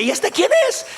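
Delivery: high, startled question; surprised. Acted. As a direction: sound shocked at who he's become. Hermanos asombrados por el cambio. (pregunta asombrada, muy aguda)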